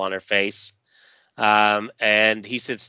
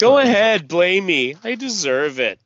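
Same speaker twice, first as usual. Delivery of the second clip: pitched-up, sulky "blame me"; self-pitying; 9 semitones higher, 7 dB louder than usual. Acted. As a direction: throw the blame on yourself, mopey. Beck's self-pitying inner voice.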